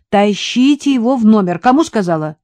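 insist, losing patience. A narrator's reading. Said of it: "вновь повысила голос Катя".

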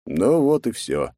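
usual speaking voice

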